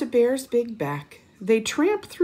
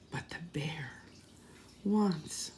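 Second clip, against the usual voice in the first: soft hush; 9 dB quieter than usual. Shown as a demonstration. Refrain whispered, building suspense.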